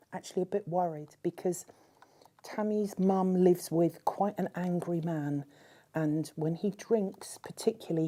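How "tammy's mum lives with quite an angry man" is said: ordinary talk, nothing marked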